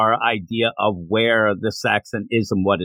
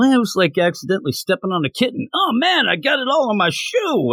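flippant jokey brother voice, jumping way higher on the shoe line; mocking gallows humor; 9 semitones higher than usual. Reviewer mimics the brothers joking about killing, mock flippant. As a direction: toss it off like a callous joke about a kitten. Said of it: "gallows humor it is there".

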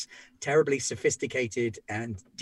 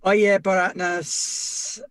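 Belter accent attempt; 7 semitones higher, 5 dB louder, slower than usual. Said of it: "God, where's the accent?".

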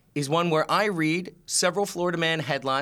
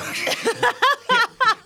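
loud high laugh; burst of laughter; 6 dB louder than usual. Real, not acted. Cohost laughs at the wrong guesses, loud and high. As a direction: crack up.

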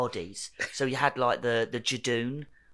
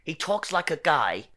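higher, louder mock New York accent; bad New York accent; 4 semitones higher, 4 dB louder than usual. Shown as a demonstration. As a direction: Englishman badly faking a New Yorker. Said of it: "which is exactly what I'm doing right now".

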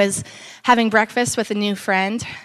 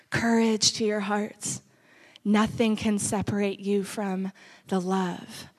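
prayer; 5 dB quieter than usual. Acted. Soft prayer over the people.

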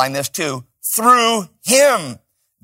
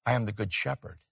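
soft prompt; 6 semitones lower, 13 dB quieter, faster than usual. Real, not acted. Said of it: "say it".

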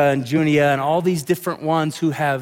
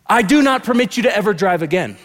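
higher and louder parent ultimatum; outraged parent; 5 semitones higher, 5 dB louder than usual. Retold or imitated. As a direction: hurl the ban like an angry parent. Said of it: "you do not say".

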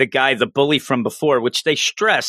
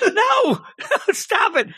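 laughing protest; slower than usual. Retold or imitated. Acting her own reaction to friend.

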